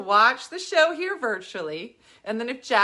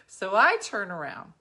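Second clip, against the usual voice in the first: ordinary talk, nothing marked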